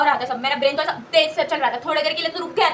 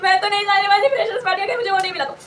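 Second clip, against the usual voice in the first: retold crying; 2 semitones higher than usual. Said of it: "रोना चालू कर दिया".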